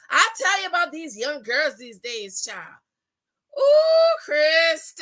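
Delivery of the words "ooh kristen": much higher, louder squeal of praise; delighted shout. Real, not acted. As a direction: cheer her name out. Cheering Kristen on.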